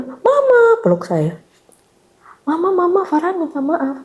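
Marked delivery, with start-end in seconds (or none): 0.0-0.8 put-on high little-boy voice calling Mama; imitating a small son's happy call; child's call. Child greeting mother at door. 2.4-4.0 put-on high little-boy voice, softening at the end; imitating a small son saying sorry to mum; child apologizing.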